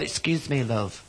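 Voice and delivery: soft, lightly voiced camp man; camp customer. Man in hot pants asking the barman, soft camp voice. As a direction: put on a softly camp request. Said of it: "I'm only acting when I do this".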